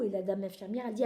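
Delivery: ordinary delivery, nothing marked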